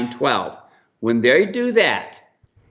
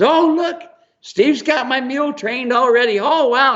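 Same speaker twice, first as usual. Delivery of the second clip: high, squealing excited lady voice; mock excited woman; 11 semitones higher than usual. Mule owner gushing to the crowd, high and mock excited.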